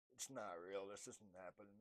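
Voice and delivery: hushed, disbelieving murmur; quiet denial. Retold or imitated. Realizing it is happening, quietly in denial. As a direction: whisper it to yourself in disbelief. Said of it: "Yeah, I'm crazy".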